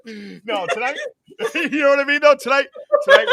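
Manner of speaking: talking through laughter; laughing. Real, not acted. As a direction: laugh while getting the words out. Laughing at own joke.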